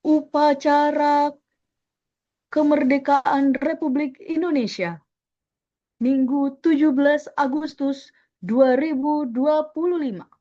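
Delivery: loud, slow ceremonial announcement; big measured voice of a palace ceremony emcee; hard-formal MC. Shown as a demonstration. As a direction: announce a state ceremony, firm and drawn out word by word. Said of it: "Itu dia volumenya keras".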